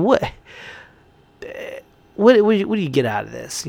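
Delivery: ordinary talk, nothing marked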